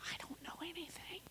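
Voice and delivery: barely audible whisper; whispered confession. Real, not acted. Speaker admitting her limits after sixteen years, whispered. Hushed confession, then said again aloud.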